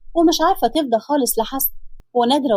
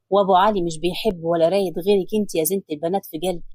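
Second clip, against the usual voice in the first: lower, tender husband's voice; loving man's voice, lower; Hassan's voice; 6 semitones lower than usual. Acted. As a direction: soothe her as her husband, lower.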